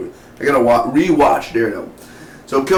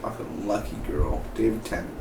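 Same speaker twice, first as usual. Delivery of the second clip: envious aside; 2 semitones lower, 10 dB quieter than usual. Retold or imitated. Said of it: "I'm like".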